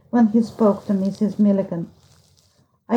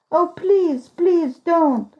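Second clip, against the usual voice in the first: pleading; 6 semitones higher, much slower than usual. A narrator's reading. Narrated boy begging her again.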